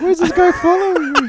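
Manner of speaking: put-on the followed concert-goer panicking in a silly accent; scared foreign boy. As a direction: play the nervous little Italian boy. Voices the followed Italian guy.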